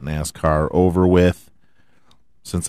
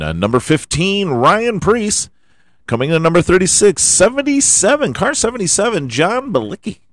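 higher, punchy rundown of the finishers; race results announcer; 7 semitones higher, 4 dB louder than usual. Acted. Reading the finish order to fans, announcer style. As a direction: call each finisher like an announcer.

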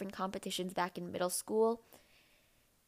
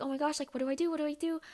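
higher, panicky mom voice; frantic mom; 7 semitones higher, much faster than usual. Retold or imitated. Mom running around the kitchen, mock frantic and higher. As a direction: imitate a mom in a mild panic.

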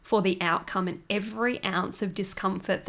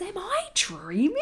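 stunned disbelief; 11 semitones higher than usual. Retold or imitated. Doula stunned on arrival.

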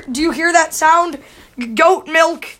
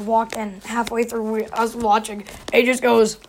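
ordinary talk

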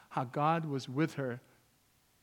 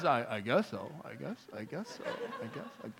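very low, hushed, halting concession; barely audible, hesitant mumble; minimizing; 4 semitones lower, 6 dB quieter than usual. Acted. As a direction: act out a reluctant, stammered admission. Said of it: "then people minimize it".

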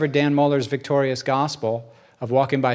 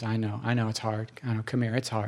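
soft comforting; 5 semitones lower, 9 dB quieter than usual. Retold or imitated. Father comforting weeping son.

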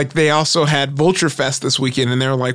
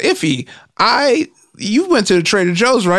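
Ronnie bit; 7 semitones higher than usual. Retold or imitated. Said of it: "Ronnie would be like".

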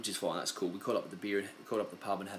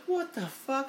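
high squeaky mock outburst; shocked disbelief; slower than usual. Teen hearing parents' news, high shocked outburst. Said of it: "I was like".

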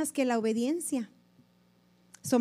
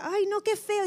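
high, prissy "ay no, qué feo"; mock-dainty disgust, high; squeamish disgust; 8 semitones higher than usual. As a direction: imitate her horrified little cry, pitched up. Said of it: "como decía ella".

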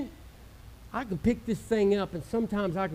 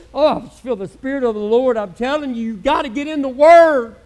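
building to a loud, pressing call; fervent exhortation; 4 semitones higher, 9 dB louder than usual. Real, not acted. Pastor urging the congregation to get into the Word, loudly. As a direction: drive it home to the pews.

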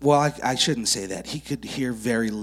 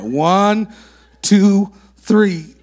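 louder, higher race-start count; countdown call; 6 semitones higher, 10 dB louder, much slower than usual. Real, not acted.

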